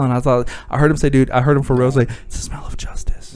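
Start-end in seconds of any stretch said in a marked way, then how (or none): 2.3-3.4 hushed, voiceless hero line; mock-heroic whisper. Comedian retelling his line to the thief, whispered. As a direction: whisper it like a corny action-hero punchline.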